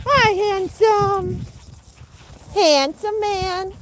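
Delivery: slow high cooing. Real, not acted. Cooing at goose.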